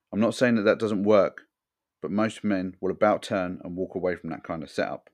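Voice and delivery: ordinary talk, nothing marked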